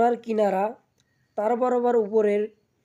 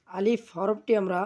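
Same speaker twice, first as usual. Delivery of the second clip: normal talking voice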